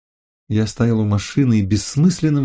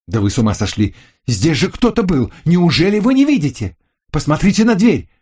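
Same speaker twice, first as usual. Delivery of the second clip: exasperated shout; 8 semitones higher than usual. Acted. Narrator shouts back in quarrel.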